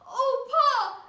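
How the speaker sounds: loud, high frightened cry; little boy crying out. Acted. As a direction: cry out in alarm to your father. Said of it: "The little boy cried".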